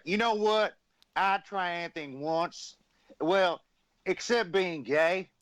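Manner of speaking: high, slow, drawn-out wrestler voice; slow, high mock Honky Tonk Man; Honky Tonk Man impression. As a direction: ham up a boastful old wrestler. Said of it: "Dude, you did a perfect imitation of him".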